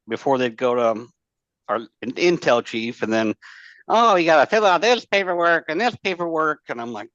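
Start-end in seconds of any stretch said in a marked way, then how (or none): 3.9-6.6 higher-pitched retold griping about paperwork; mock complaint. Marines grumbling to him about forms, mock complaint. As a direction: imitate someone fussing over forms.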